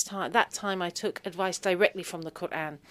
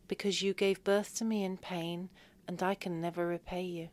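softer sincere words; tender; 8 dB quieter than usual. Retold or imitated. Daughter telling mum why, softly. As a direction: say it gently from the heart.

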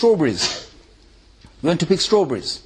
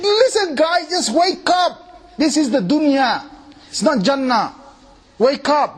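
mock wake-up call; 8 semitones higher, slower than usual. Speaker voices inner rebuke.

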